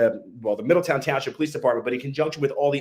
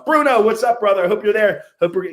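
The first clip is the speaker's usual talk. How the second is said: loud, higher-pitched shout-out; calling out greeting; 7 semitones higher, 6 dB louder than usual. Real, not acted. Host hailing his friend Bruno, calling out loudly. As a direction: hail a buddy across the room.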